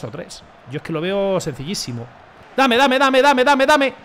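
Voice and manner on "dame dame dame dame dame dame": loud, high, rapid-fire repeated demand; excited urging. Real, not acted. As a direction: call urgently for the ball. Pide el balón en el juego. (pidiendo el balón a gritos)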